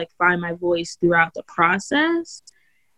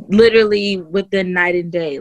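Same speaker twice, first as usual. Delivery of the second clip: ordinary talk, nothing marked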